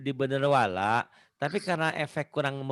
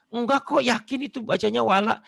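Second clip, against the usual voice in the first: higher-pitched insistent pupil; arguing pupil; 8 semitones higher than usual. Retold or imitated. Teacher imitating a pupil contesting the judge, higher insistence. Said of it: "sering debat".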